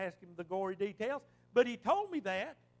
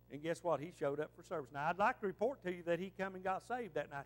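ordinary talk, nothing marked

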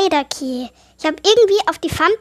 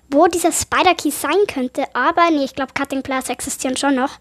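normal talking voice

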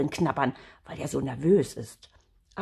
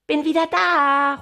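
high, loud homecoming shout; calling out; 11 semitones higher, 7 dB louder than usual. Acted. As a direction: sing out that you're home. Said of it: "ruft er".

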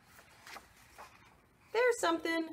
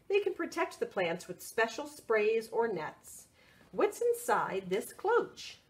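lively picture-book read; reading to children; 6 semitones lower than usual. Acted. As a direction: read it out brightly for little ones.

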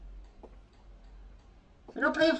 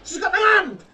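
panicked yelling; 5 semitones higher, 10 dB louder than usual. Real, not acted. Panicked when grabbed.

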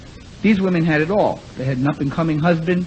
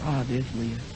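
a quiet, deflated sigh; sighing husband spotting his wife; weary sigh; 5 semitones lower, 10 dB quieter than usual. Acted. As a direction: mumble it like a tired husband.

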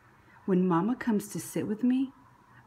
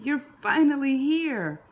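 higher, louder joyful greeting; 6 semitones higher, 6 dB louder than usual. Acted. Big sister greets her newborn sibling, joyful and high. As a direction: burst out with delight.

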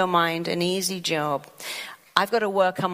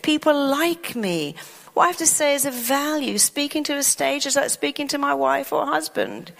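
upbeat self-talk; 7 semitones higher than usual. Acted. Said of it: "Or you can go".

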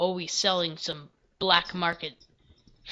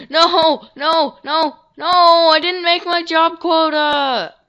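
much higher, louder, slow drawn-out despair; anguished wail; 8 semitones higher, 11 dB louder, slower than usual. Real, not acted. Player missing the job quota, loud high wail.

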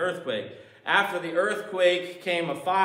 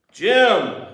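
mock bell sound; 4 semitones higher, 11 dB louder than usual. Imitated bell or shake sound.

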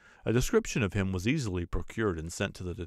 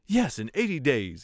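raised, louder burst of excitement; excited exclamation; 7 semitones higher, 5 dB louder than usual. A narrator's reading. Stewart at whist exclaims in sudden excitement. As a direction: blurt it out as the excitement takes over. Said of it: "exclaimed Stewart".

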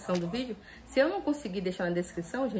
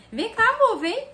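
much higher, louder cooing call; 12 semitones higher, 8 dB louder than usual. Real, not acted. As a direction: coo to a little dog. Calling the puppy over. (voz de falar com cachorrinho)

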